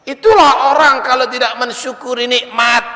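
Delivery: shouted moral, high and loud; preaching shout. Real, not acted. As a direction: hammer the lesson home at full voice. Preacher drives home moral. (khatib berteriak menegaskan pelajaran, tinggi dan keras)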